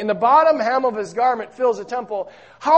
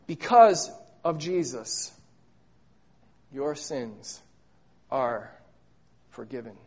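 much softer and lower, fading to a hush; tender pronouncement; 9 semitones lower, 8 dB quieter than usual. Acted. God assuring the forgiven sinner, soft and hushed. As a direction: let it land gently, like a blessing.

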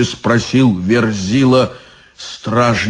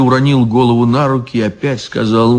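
usual speaking voice

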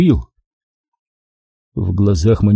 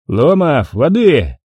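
barking order; 4 semitones higher, much slower than usual. A narrator's reading. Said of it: "рявкнул он".

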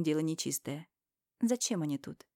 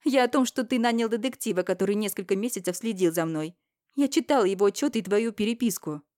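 louder, higher accusing voice; angry accusation; 3 semitones higher, 6 dB louder than usual. Acted. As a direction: throw the charge at him. Героиня обвиняет брата. (гневное обвинение, громче)

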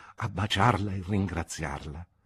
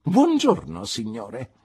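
curt dismissal; 7 semitones higher, 8 dB louder, slower than usual. Acted. Aunt dismisses Marston.

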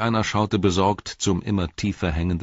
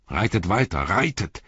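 raised, commanding call; 3 semitones higher than usual. A narrator's reading. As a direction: urge the riders onward. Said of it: "rief Eomer".

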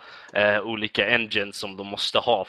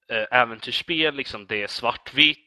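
normal talking voice

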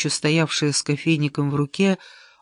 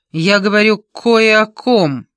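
loud, higher, deliberate announcement; pointed accusation; 5 semitones higher, 8 dB louder than usual. A narrator's reading. Keith about to expose the secret, loudly pointed. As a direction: drop the hint forcefully.

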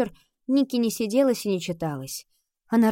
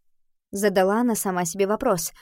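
ordinary delivery, nothing marked

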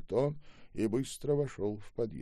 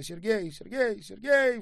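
repeated high cries, each higher and louder; shrieking his name; 14 semitones higher, 8 dB louder than usual. A narrator's reading. Mother clutching her returned son, shrieking out. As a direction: cry out the name in rising bursts. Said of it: "вскрикивала женщина".

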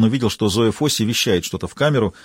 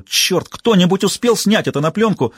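eager shout; 8 semitones higher than usual. Acted. Reporter thrilled by the fight.

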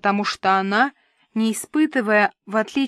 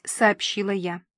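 usual speaking voice

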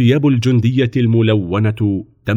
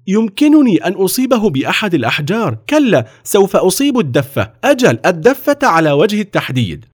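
put-on high-pitched boyish determination; insistent confident boy; 10 semitones higher, 3 dB louder than usual. A narrator's reading. Napoleon insisting to the officer, determined.

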